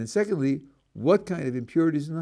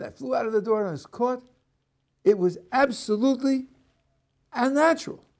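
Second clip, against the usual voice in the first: much higher, amazed voice of a man saved; breathless survivor; 8 semitones higher than usual. Acted. Rescued driver reliving the crash, higher and excited. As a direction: tell it like you just escaped death. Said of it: "One is".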